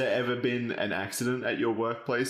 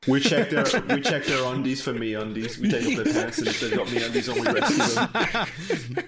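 laughing; 3 semitones higher than usual. Real, not acted. Joking sponsor rescue bit.

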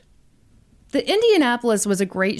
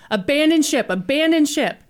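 calling out the order, higher; shouting abandon ship; 6 semitones higher than usual. Retold or imitated. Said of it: "just had to shout it".

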